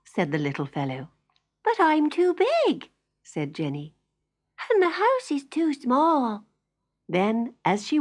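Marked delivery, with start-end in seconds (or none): 1.6-2.9 put-on high little girl voice; a child worried she won't fit. Jenny telling the fairy she is too big, high and childlike. 4.7-6.4 put-on high little girl voice; a child worried she won't fit. Jenny telling the fairy she is too big, high and childlike.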